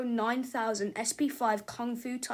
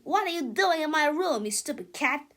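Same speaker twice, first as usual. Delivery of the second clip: higher, louder scolding broken by a sneeze; angry sneezy rant; 6 semitones higher, 9 dB louder than usual. Acted. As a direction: shoo the cat while sneezing. Melvin yells at cat.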